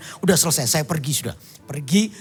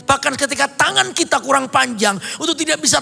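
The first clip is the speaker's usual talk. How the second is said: high-pitched, driving sermon voice; fervent preaching; 9 semitones higher, 4 dB louder than usual. Acted. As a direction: preach it to the congregation with fire. Preaching at full force.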